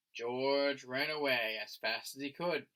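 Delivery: usual speaking voice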